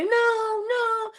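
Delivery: put-on high, tearful child's no; high crying child voice; crying little girl. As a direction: wail no as the child runs off. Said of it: "she starts to run away".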